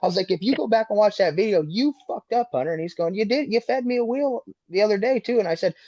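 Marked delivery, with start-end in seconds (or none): 0.3-2.7 high-pitched retold comeback; heated accusation. Driver arguing back at rival, heated accusation. Retold quarrel after the crash. 3.2-5.4 high-pitched imitation of an arguing rival; heated counter-accusation. Rival driver defending himself after wreck, heated counter-accusation. Rival's retort in retold quarrel.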